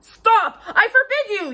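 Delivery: loud, high, desperate shout; panicked yell. A narrator's reading. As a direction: yell to stop him in panic. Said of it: "yelled Uncle Vernon in panic".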